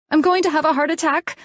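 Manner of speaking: panicked cry. A narrator's reading. Terrified patient crying out to the room, high and fast. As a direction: cry out in terror. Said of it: "I cried".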